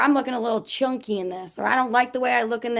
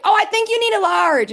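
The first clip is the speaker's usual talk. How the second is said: mock mom call, higher and louder; mom yelling; 9 semitones higher, 6 dB louder than usual. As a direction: imitate mom announcing it to the whole store. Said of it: "she'd be yelling in the thing".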